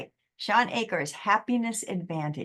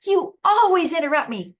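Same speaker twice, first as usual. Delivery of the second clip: higher and louder, stressing always; mock accusation; 10 semitones higher, 8 dB louder than usual. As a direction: mimic a raging partner's blame. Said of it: "they just said something that wasn't true".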